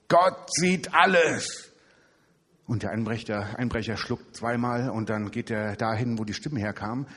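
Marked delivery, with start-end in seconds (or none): 0.1-1.7 high, slow, loud ghostly call; eerie slow proclamation; booming hidden voice. Acted. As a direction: boom it out like a voice from nowhere. Hidden voice scaring burglar.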